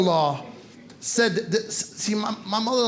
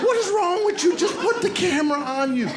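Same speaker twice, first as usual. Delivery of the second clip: high-pitched mock exasperation; hammed-up complaint at a video caller; exasperated; 9 semitones higher than usual. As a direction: scold in a high, strained voice. Acts out exasperation at Skype.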